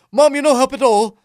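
high, loud Irish lad egging on his dad; Irish kid impression. Irish son narrating his dad chasing a bat, Irish accent. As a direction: cheer him on in a young Irish voice. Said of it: "he's like, he's like".

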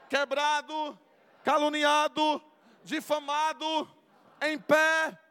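loud, high shouted sermon list; full-force preaching. Acted. Preacher urging the congregation, loud preaching. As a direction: hammer each word to the crowd.

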